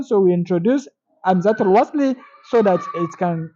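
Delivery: ordinary talk, nothing marked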